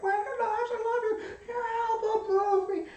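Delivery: squealing fans, pitched very high; gushing crowd, high and breathless; screaming fans. Retold or imitated. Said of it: "They're screaming".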